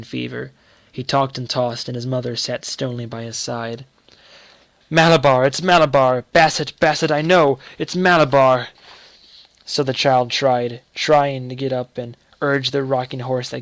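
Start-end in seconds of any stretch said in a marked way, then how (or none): 4.9-8.7 loud, delirious cries; feverish raving. A narrator's reading. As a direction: rave in fever.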